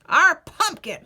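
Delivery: put-on loud old man's holler; old man hollering at the ghoul. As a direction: bellow it at the ghoul. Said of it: "hollered the old man".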